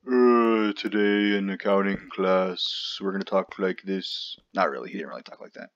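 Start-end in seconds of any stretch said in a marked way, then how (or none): 0.1-4.4 lightly voiced droning lecturer, slow at first; a hint of a monotone accounting professor; zombie professor voice. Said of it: "I had a professor that used to talk like that".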